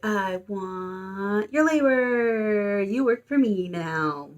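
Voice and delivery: long, drawn-out hammed-up claim; mock-possessive demand. Ghost boy claims her as his servant, hammed-up demand. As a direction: draw out the words like a teasing villain.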